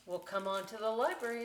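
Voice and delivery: plain reading voice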